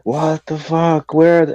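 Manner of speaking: frantic outburst. Retold or imitated. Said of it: "I was like".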